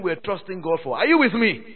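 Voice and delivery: ordinary talk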